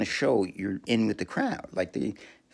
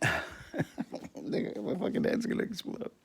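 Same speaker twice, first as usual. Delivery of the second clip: laughing; 12 semitones higher, 6 dB quieter, much slower than usual. Real, not acted. Laughing in disbelief.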